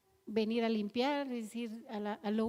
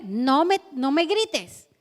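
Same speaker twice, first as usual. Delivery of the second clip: put-on louder petulant child protest; sulky child; 2 semitones higher, 11 dB louder, slower than usual. A child answering a yelling parent, petulant and loud. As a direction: whine it back like a stubborn kid. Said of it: "Se pone".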